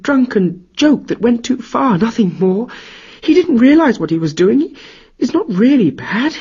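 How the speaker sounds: wife pleading for her husband's life. Acted. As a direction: beg the god to spare your husband. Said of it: "Sigyn pleaded with him".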